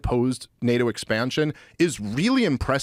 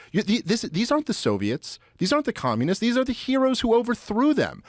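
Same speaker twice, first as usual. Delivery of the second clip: higher-pitched, heated exasperated rant; impassioned imitation of an angry diplomat, pitched up; retold furious rant; 11 semitones higher than usual. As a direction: channel an outraged old Cold Warrior scolding Washington. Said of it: "And he's like".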